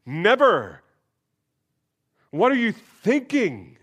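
indignant Peter. Retold or imitated. Pastor acting out Peter refusing the king's washing, indignant. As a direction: ask it as if the idea is outrageous.

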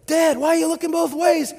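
high, loud mock kid's yell; yelling child. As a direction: shriek it like an alarmed child at Dad. Voices child yelling at dad.

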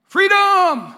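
huge high roar of freedom; Braveheart battle cry. Retold or imitated. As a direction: bellow it like a warrior leading a charge.

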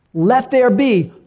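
high, loud, slow commanding call; a pastor acting God's voice, booming. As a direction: proclaim it like creation itself. God speaking creation, acted.

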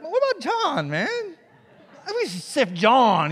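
mock Peter pleading. Peter trying to dodge Satan's sifting, pointing at John, in a mock plea. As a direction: pass the blame off with a grin. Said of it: "I'd be like".

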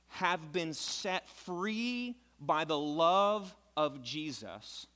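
very slow, drawn-out build to the point; slow emphatic preaching. Acted. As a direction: savor each phrase toward delight. Climactic sermon point.

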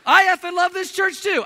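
high, loud, joking outburst; mock exuberant agreement. Pastor jokingly agreeing with the swearing churchgoer, over-the-top excited. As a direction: echo the blunt praise with comic gusto.

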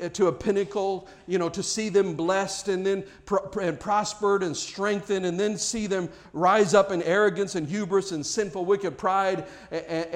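preaching. Acted. Preaching builds again.

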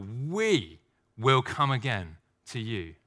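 slow, with We leaned on; stress on We. Shown as a demonstration. Preacher slowly stressing We in the verse. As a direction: draw it out and land on We. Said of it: "and it's plural".

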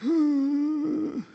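sharp high mock-shocked gasp. Moms in the congregation gasping at no handwashing, a high shocked gasp. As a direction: gasp like a scandalized mom. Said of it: "Some of you moms just went".

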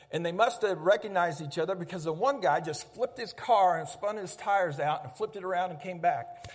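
ordinary talk, nothing marked

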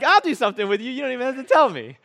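hammed-up eager volunteer; eager Josh. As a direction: play Josh jumping at the chance, loud and quick. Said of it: "Josh is like".

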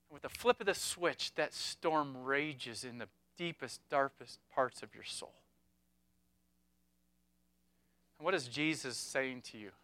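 preacher comforting the bereaved in the church, softly; hushed and tender. Real, not acted. Preacher addressing the grieving listeners.

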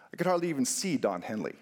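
usual speaking voice

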